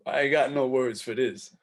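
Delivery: ordinary talk, nothing marked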